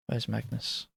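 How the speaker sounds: ordinary talk, nothing marked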